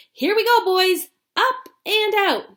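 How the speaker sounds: loud high triumphant Wonka; triumphant shout. Acted. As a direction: announce the launch with glee. Wonka launching the lift.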